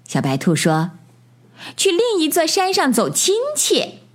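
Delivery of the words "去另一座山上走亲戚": high-pitched bunny reply; high, childlike and eager; little rabbit child. A narrator's reading. Little rabbit answering the bird.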